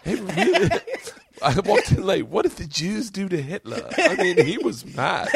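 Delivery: put-on high British accent through laughter. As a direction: crack up while doing a British comic's voice. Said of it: "I'm gonna do it with your accent".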